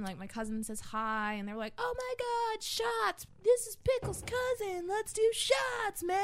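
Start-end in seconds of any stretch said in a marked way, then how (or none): 1.8-6.2 very high, drawn-out party voice; hammed-up high-pitched excitement, words stretched; excited partiers, imitated. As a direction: gush like thrilled drinking buddies. Acts out excited drinkers greeting cousin.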